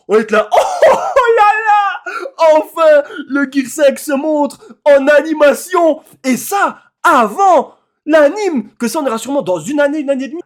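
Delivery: screaming with joy and shock, slowed; real hype. Real, not acted. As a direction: yell it like the best moment ever. Recounting the big reveal.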